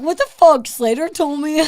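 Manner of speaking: high, loud mock outrage; imitated indignant outburst. Retelling a misled comic's rant, mock indignation. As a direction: burst out as the wronged comic. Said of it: "And he's like".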